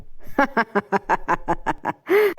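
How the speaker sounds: long teasing laugh; mocking laugh. A narrator's reading. Husband laughing at wife's mistaken guess, mocking laugh. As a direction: laugh at her expense.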